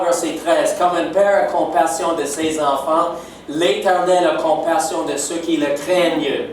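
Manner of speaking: ordinary talk, nothing marked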